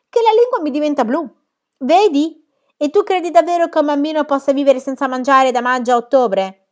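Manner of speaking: più alta e forte, spavalda; esuberante. Acted. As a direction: sfoggia le bugie con orgoglio. Pippi ammette le bugie, esuberante. (Pippi che si vanta delle sue bugie, acuta e forte)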